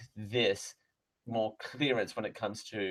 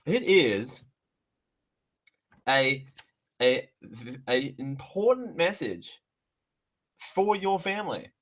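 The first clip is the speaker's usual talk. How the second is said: mock dramatic announcement; 3 semitones higher, 6 dB louder than usual. Reads mailer heading dramatically.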